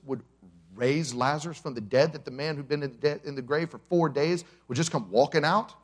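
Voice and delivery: ordinary talk, nothing marked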